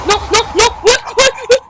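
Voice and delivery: high, loud panicked shouting; screaming in fright at the monster; panicked yell. Real, not acted. Gamer shrieking in panic at a sudden monster.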